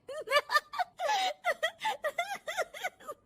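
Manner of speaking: high-pitched giggle; long, high burst of giggles; giggling laugh. Acted. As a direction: laugh away at the TV. Bahu laughing at TV serial.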